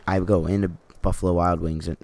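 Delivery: ordinary talk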